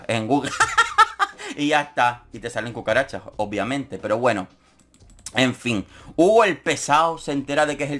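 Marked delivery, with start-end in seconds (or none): 0.6-1.5 high squealing laugh; high cackle. Real, not acted. Vlogger laughing at her cucas joke, high squealing laugh. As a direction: let out a high giggle.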